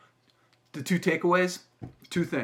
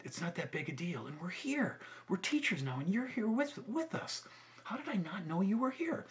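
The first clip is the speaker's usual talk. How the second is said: soft, higher-pitched imitation of her; gracious, kind reassurance; 7 semitones higher, 4 dB quieter than usual. Teacher forgives former student after years, kind and reassuring. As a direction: forgive him gently, voice lifting and light. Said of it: "so gracious and so kind".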